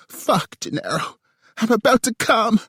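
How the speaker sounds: high, loud climax scream. A narrator's reading. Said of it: "she screamed".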